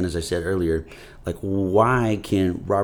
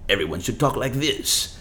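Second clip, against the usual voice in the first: lightly put-on suave Ricardo Montalban accent, a touch higher; mock Montalban accent; 5 semitones higher than usual. Mocking a famous actor's Ricardo Montalban accent to the host. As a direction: poke fun at the Ricardo Montalban accent in passing. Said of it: "who's Ricardo Montalban to come and say".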